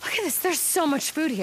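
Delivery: high, excited discovery; amazed. Acted. Sarah showing Deacon the food, amazed. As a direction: marvel at the find.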